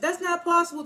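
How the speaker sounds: high, stunned "that's not possible"; shocked disbelief. Retold or imitated. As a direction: act floored by the news. Effie reacting in disbelief.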